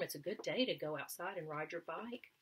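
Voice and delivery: ordinary delivery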